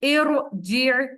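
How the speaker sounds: slow, drawn-out tajweed recitation; Quran recitation. Shown as a demonstration. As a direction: recite each syllable slowly with heavy r. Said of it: "यहाँ पर देखिए". (धीमी तिलावत)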